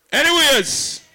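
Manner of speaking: high, loud MC intro, slowed on the name; hype announcer. Real, not acted. MC introducing [name] to the crowd, loud hype. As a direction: build them up for the artist.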